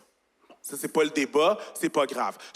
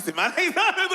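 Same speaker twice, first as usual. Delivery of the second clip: ordinary talk, nothing marked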